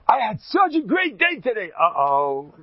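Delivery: high, cheery announcement then a worried uh-oh; imitating himself and wife. Husband coming home to his wife, overly cheery high voice then uh-oh. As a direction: sing it out too happily, then wince. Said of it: "I don't wait for my wife".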